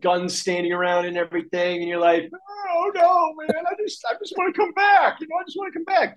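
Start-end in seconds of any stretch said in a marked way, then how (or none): 2.7-6.2 high whiny mock plea; pleading traveler. Traveller pleading with armed US customs guards, high mock whine. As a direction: beg like a nervous traveller.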